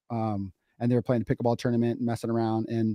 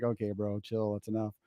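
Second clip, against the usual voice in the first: much softer, hushed retort; embarrassed aside; 9 dB quieter than usual. Retold or imitated. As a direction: play down your embarrassment under your breath. Retold embarrassed reaction at dinner.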